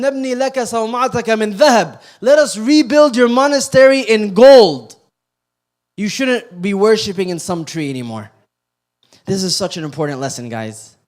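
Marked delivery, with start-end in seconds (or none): 0.0-8.3 louder, higher eager offer in Arabic then English; eager crowd offer. Retold or imitated. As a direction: fawn over him like the crowd. Crowd offering rebuild in gold.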